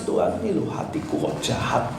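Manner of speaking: tearful prayer. Shown as a demonstration.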